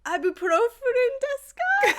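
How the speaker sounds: high, timid, laughing attempt at Japanese; nervous high-pitched question. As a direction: squeak it out nervously, giggling.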